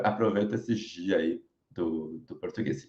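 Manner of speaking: ordinary talk, nothing marked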